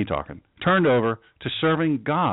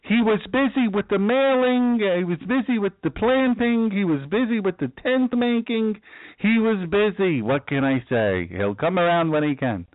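imagined excuse; 8 semitones higher than usual. Pretend. Pastor voicing Apollos' imagined excuse, higher breezy put-on voice. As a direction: play Apollos shrugging off the visit with excuses. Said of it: "He could have said".

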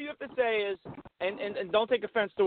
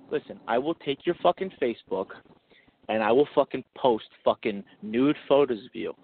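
low, measured mock menace; menacing pretend threat; 7 semitones lower than usual. Pretend husband threatening his wife over Facebook, menacing. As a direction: spell out each threat in a low voice.

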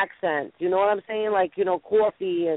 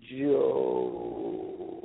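low moan; 7 dB quieter than usual. Pretend. Moaning Joe's name.

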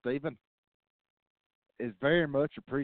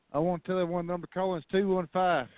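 rushed and out of breath; gasping it out fast, no air left; all in one breath; 5 semitones higher, faster than usual. Real, not acted. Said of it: "I'm out of breath".

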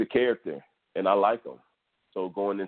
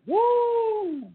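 excited whoop. Real, not acted. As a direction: celebrate the knockout with a yell. Celebrating knockout, yelling.

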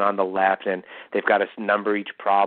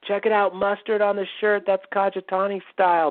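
showy announcing; 10 semitones higher than usual. Retold or imitated. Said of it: "and went".

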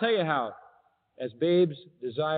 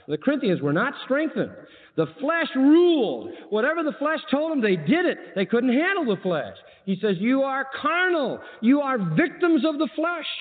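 preaching; 10 semitones higher than usual. Acted. Preaching on the flesh.

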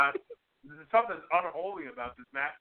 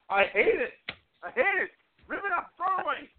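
mock outrage; 13 semitones higher than usual. Real, not acted. Mock outrage over the note.

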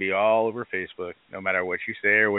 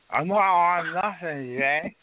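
slow, higher, slurred mock denial; high employee denying; 7 semitones higher, much slower than usual. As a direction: play the stoned worker swearing she's clean. Boss imitates high employee denying.

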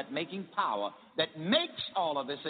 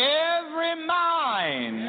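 very slow, much higher, louder call; call to repeat; 11 semitones higher, 6 dB louder, much slower than usual. Shown as a demonstration. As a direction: stretch it out for the crowd. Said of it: "repeat this after me".

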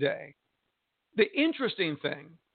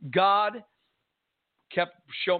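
ordinary delivery

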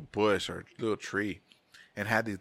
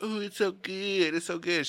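higher, drawn-out "ooh" of delight; imitated wife; 7 semitones higher than usual. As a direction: coo over the food in a mock wife voice. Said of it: "my wife ... she's like".

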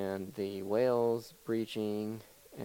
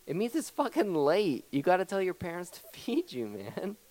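higher-pitched exasperated scolding; mock exasperation; 7 semitones higher than usual. Host scolding hungry kid visiting at midnight, in mock exasperation. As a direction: scold a clueless visitor, half amused.

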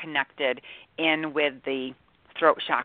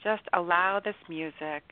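guided meditation; 3 dB quieter than usual. Acted. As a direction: ease them into calm, softly. Guided meditation over music.

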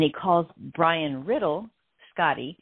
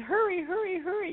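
urgent hurry; 14 semitones higher than usual. Retold or imitated. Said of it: "Brian says".

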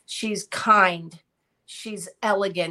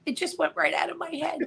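usual speaking voice

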